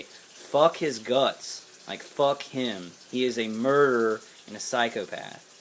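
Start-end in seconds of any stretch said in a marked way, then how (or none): 0.0-1.6 each word dragged out slowly; slow emphatic curse. Shown as a demonstration. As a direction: spit it out, slow and heavy. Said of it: "I wanted to say".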